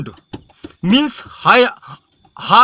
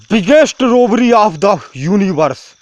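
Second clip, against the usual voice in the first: usual speaking voice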